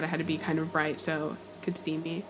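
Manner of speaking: normal talking voice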